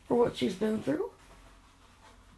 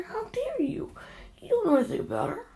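angry outburst; 10 semitones higher than usual. Real, not acted. Grandparent scolding the trolls over a granddaughter, in an angry outburst. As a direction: let the anger break through. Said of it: "I'm just angry".

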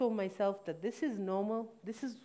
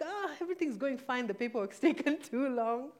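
fake cheerful cover; 6 semitones higher, 3 dB louder than usual. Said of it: "And I'm like".

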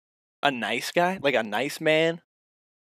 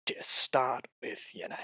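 put-on whispered Finding Nemo style accent; hushed imitation accent from Finding Nemo; put-on film accent; 9 dB quieter than usual. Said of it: "not to do an accent".

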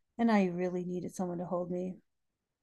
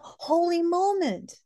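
much higher and louder, slow joyful cry; elated; 10 semitones higher, 5 dB louder, slower than usual. Real, not acted. Correcting herself about her sister's movement, elated and high. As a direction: ring it out high with joy. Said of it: "Not holy cow".

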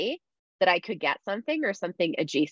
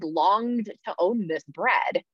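ordinary talk

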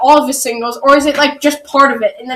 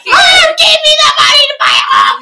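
mock wailing; 11 semitones higher than usual. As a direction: beg in a hammed-up whine. Mock begging for money.

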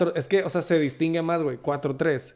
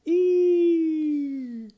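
loud; 14 semitones higher, 6 dB louder than usual. Pretend. Drawn-out high call.

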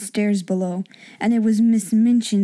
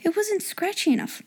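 put-on higher girl's voice; 9 semitones higher, 3 dB quieter than usual. Girls hear noise on roof.

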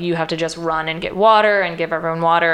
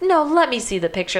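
higher-pitched, pressing demand; insistent; 7 semitones higher than usual. Retold or imitated. Inmate pressing Dragline for the picture, insistent. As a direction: imitate someone who won't be refused.

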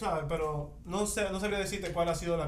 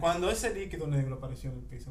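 ordinary talk

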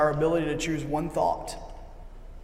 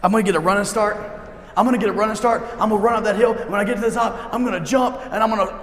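higher, louder, breathless-to-go excitement; eager twelve-year-old boy; 5 semitones higher, 5 dB louder, faster than usual. Acted. Boy spotting a hill to jump off, loud and excited. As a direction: pitch it up like a kid raring to run. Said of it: "the twelve-year-old boy in me".

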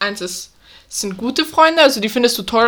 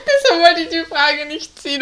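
talking through tearful laughter; crying with laughter; laughing; 6 semitones higher than usual. Real, not acted. Lacht bis zu Tränen. (unter Tränen lachend)

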